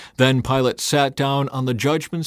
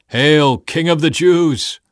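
loud, slow, raised mock hail; taunting; 5 semitones higher, 8 dB louder, slower than usual. A narrator's reading. Soldiers kneeling before Jesus, taunting. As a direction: jeer it like a false royal salute. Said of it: "in mockery and taunted".

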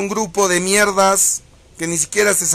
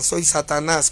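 ordinary delivery

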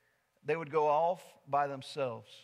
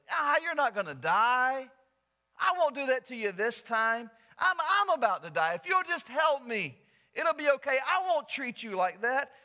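louder, higher coaxing snake voice; pleading snake; 9 semitones higher, 6 dB louder than usual. Acted. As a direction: wheedle him into carrying you.

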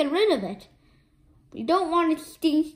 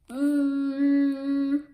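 usual speaking voice

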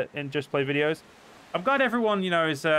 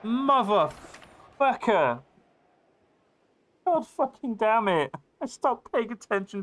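furious outburst; 9 semitones higher, slower than usual. Real, not acted. Streamer punished for looking away, yelling in fury. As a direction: explode at your own mistake.

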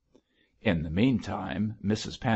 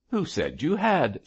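higher-pitched, irritable snap; snapping; 8 semitones higher than usual. A narrator's reading. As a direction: cut her short with a sharp question. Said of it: "snapped her father".

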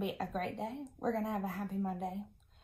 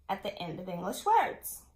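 usual speaking voice

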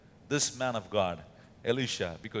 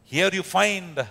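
normal talking voice

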